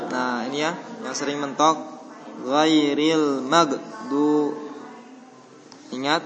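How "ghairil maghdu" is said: Ghairil maghdu drawn out very slowly, vowels flowing; flowing recitation. Shown as a demonstration. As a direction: let Ghairil maghdu flow slowly and evenly. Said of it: "wa itu ngalir".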